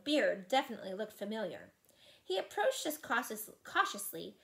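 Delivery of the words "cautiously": ordinary delivery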